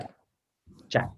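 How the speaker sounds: short 'Jack', roughly spelt J-A-K; brief demonstration of 'Jack' as a Thai word; J-A-K sound. Shown as a demonstration. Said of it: "It's like J A K".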